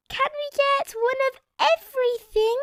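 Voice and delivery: put-on high eager child voice; greedy little pleading; Peppa asking. A narrator's reading. Peppa asking her parents for everything, high. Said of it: "asked Peppa".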